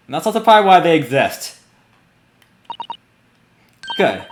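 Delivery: ordinary delivery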